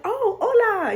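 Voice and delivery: high startled oh, hola; coffee shop man taken aback, surprised hello. Retold or imitated. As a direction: caught off guard, answer the hello. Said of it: "I guess he was shocked".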